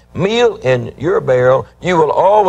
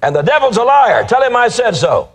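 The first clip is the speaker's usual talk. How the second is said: higher and louder, punch liar; fiery preaching; 8 semitones higher, 3 dB louder than usual. Acted. Preacher to congregation, calling the devil a liar, fiery and loud. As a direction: defy the devil from the pulpit.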